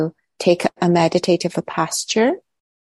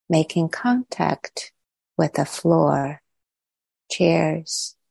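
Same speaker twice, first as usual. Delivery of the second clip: calm, unhurried meditation voice with long pauses; guided meditation; 3 dB quieter than usual. Real, not acted. Teacher settling the group into body and breath, calm guided meditation. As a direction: guide them gently inward, leaving long silences between phrases.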